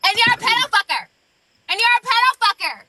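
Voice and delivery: high mocking squeal imitating Sassy; mock her in a high, shrill-free squeak; Sassy impression. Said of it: "my best Sassy impression".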